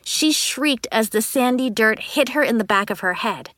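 plain reading voice

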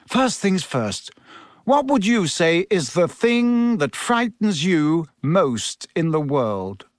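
slow, measured teacher's questioning; Professor Lupin. Acted. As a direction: draw the question out for a nervous pupil.

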